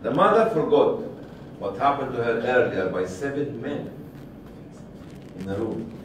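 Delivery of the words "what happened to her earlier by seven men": ordinary delivery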